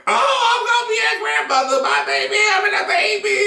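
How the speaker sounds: very high, squealing southern-mom imitation; excited southern mom. Son imitating his mom learning she'll be grandmother, excited southern squeal. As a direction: gush fast in a mock southern mama squeal. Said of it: "My mom is so southern".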